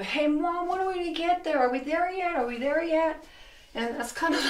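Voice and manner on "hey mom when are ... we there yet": put-on higher, whiny child asking Mom; impatient kids in the car. Teacher imitating kids nagging Mom in the car, higher and whiny. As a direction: play the antsy kid in the back seat. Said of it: "the kids in the car, you go".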